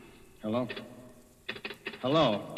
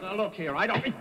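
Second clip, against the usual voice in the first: rattled; 9 semitones higher, 5 dB louder than usual. Acted. Franklin cut off, rising panic.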